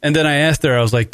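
ordinary talk

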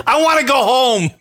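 half-joking cry for home; mock shout. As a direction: yell it like a stranded kid. Mock despair after racist driver.

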